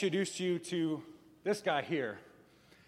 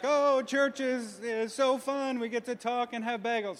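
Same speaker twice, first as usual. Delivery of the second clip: high, slow gushing; a hint of a giddy extrovert, higher and slow; mock gushing extrovert; 7 semitones higher than usual. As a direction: play the overexcited churchgoer. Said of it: "and they're like".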